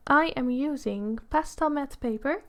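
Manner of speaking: ordinary delivery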